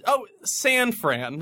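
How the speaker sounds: slow, drawn out San Fran; mock local slang. Naming the next tour city to the cohost, in mock-local slang. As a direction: lean into the nickname. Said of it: "That's what the locals call it".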